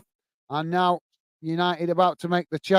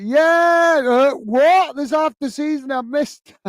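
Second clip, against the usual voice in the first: much higher and louder, a shocked yelp of "what?"; mock outrage; 8 semitones higher, 4 dB louder, slower than usual. Playing Joey opening a half-used season ticket, high shocked shout. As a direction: act out Joey's disbelief at the gift. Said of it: "It's like".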